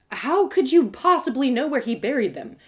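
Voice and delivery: ordinary delivery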